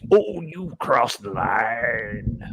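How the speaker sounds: very slow, drawn-out promo threat; pro wrestler style. Shown as a demonstration. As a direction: stretch every word like a wrestler. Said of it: "pro wrestler style".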